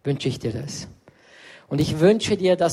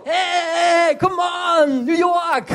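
high, shouted hype; loud teasing come-on shout; friend's pushy cheer; 12 semitones higher, 4 dB louder, much slower than usual. Retold or imitated. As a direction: cheer him on like an annoying hype man. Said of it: "dann ist so".

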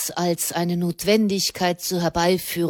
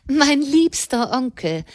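playful call; 7 semitones higher than usual. A narrator's reading. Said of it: "rief Madame".

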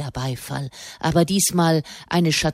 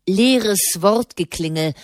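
ordinary delivery

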